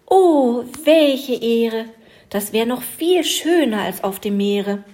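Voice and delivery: a loud, high gasp of wonder; overwhelmed by the honour, crying out; amazed. A narrator's reading. Little fir tree amazed at becoming a Christmas tree, loud and high. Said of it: "Da staunte das Bäumchen".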